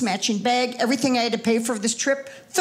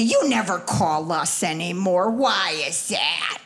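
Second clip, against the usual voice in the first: low, slow guilt-tripping cousin; guilt-trip cousin; 7 semitones lower, slower than usual. Retold or imitated. The cousin guilt-tripping her about never calling, in a low slow voice. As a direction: drag it out, lower and slower. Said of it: "she says to me".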